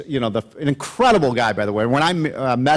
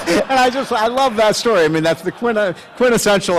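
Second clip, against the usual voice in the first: talking through real laughter, pitched up; laughing; 9 semitones higher, 4 dB louder than usual. Real, not acted. Speaker to audience, laughing at the punchline. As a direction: still laughing while speaking.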